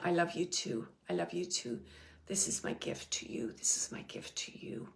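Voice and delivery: soft, fading almost to a whisper; tender message. Retold or imitated. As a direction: relay grandma's love in a hushed voice. Relaying grandmother's love to Kayla.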